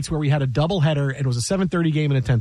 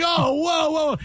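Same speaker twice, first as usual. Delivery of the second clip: high startled cry of whoa; 11 semitones higher, slower than usual. Retold or imitated. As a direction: react like someone just cursed on live air. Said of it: "it was like".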